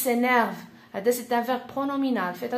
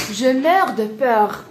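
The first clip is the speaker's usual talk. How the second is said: loud, slow, dramatic fear; acted terror; 8 dB louder, slower than usual. Shown as a demonstration. French teacher demonstrating dying of fear, loudly dramatic. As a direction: stretch it out with hammed-up dread.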